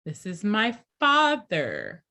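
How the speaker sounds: father stretched and stressed, slow; teacher stressing father for the class. Shown as a demonstration. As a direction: lean on the word father for learners. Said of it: "I'm emphasizing father".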